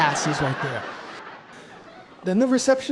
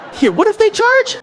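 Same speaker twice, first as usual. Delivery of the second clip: alarmed; 12 semitones higher, 7 dB louder than usual. Acted. Panics over champagne cost.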